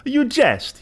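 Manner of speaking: high startled exclamation; exclaiming. A narrator's reading. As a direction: jolt the voice up in disbelief. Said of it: "he exclaimed".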